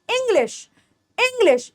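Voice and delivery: English with a brief "ih" opening; English said softly as "ing-lish"; short I. Shown as a demonstration. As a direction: keep the first vowel of English short. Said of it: "ई की sound छोटी".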